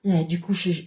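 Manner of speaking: ordinary talk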